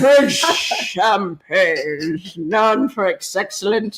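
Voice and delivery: very slow, slurred, sagging delivery; slurring drunk Orson Welles. Retold or imitated. Retelling the champagne ad outtake, slurring drunk. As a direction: drag the words out like a sozzled pitchman. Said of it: "he's just slurring and slurring and slurring".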